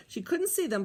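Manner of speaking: ordinary delivery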